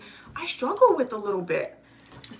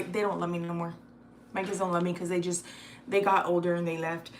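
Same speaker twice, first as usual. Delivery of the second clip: normal talking voice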